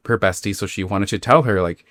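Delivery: usual speaking voice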